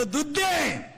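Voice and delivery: a child's drawn-out wail for milk, lightly imitated; demanding child. Child to father asking for milk, imitated demanding child. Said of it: "ते cart म्हणलं".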